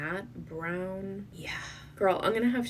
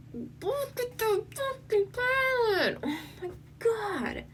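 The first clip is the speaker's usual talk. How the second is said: excited squeal. Real, not acted. Gasping at the eyeshadow pan.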